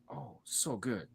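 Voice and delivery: soft, hushed imitation of a child; blissful four-year-old. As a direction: sigh it out like a delighted kid. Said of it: "he's like".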